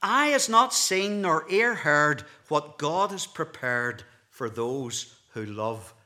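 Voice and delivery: loud, slow, ringing recitation, falling away at the end; scripture declamation. Real, not acted. Preacher repeating Paul's verse for the congregation, emphatic declamation. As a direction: proclaim the verse with force, then settle. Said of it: "Let me repeat it".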